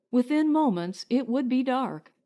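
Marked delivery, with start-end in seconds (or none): none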